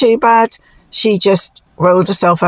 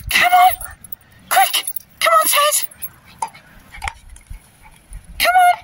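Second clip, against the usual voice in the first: very high, short recall calls; calling dogs; 6 dB quieter, faster than usual. Real, not acted. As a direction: urge the dogs back in a high call. Calling dogs to hurry back.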